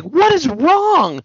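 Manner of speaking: high, drawn-out mock wife voice; imitating the wife. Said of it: "And his wife's like".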